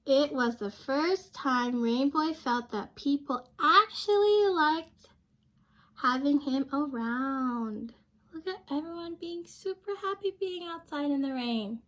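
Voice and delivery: lively picture-book narration for a class; reading to children. A narrator's reading. Teacher reading a picture book aloud to her class. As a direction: read it like story time for young kids.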